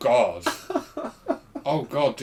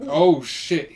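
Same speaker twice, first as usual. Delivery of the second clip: loud startled cry; alarmed shout; 4 semitones higher, 9 dB louder than usual. Pretend. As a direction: yell it in shock. Mock birth, shouting alarm.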